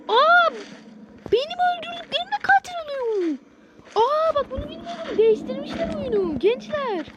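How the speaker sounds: high-pitched, astonished telling; real amazement that the game changed; amazed discovery. Real, not acted. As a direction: sound truly taken aback.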